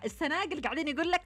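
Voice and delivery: ordinary delivery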